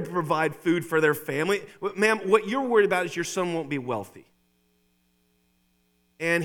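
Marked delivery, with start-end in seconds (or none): none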